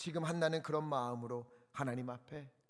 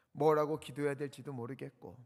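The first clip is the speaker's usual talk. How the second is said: ordinary delivery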